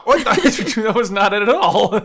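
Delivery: high, loud words through laughter; laughing disbelief. Real, not acted. Player whose game move went wrong, laughing disbelief. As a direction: crack up at your own failed move.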